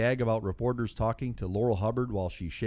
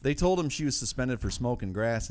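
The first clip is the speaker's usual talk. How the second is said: normal talking voice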